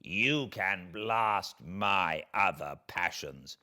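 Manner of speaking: the wronged creature, bitter and threatening, slow on the warnings; vengeful creature. Acted. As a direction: speak as a spurned monster promising revenge. Creature threatens his maker.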